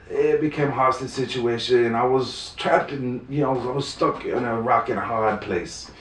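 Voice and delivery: ordinary delivery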